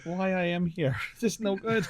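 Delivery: a hint of the bird's vague foreign accent, weary and amused; mock broken-English bird voice. Walking into the office, weary, in a mock foreign bird accent. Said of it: "I'd walk into the office like".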